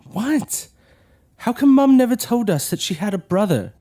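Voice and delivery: angry outburst. Acted. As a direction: push back hard at her.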